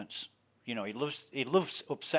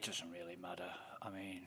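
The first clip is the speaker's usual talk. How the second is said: whisper; 5 semitones lower, 16 dB quieter than usual. Real, not acted. Sudden whispered aside.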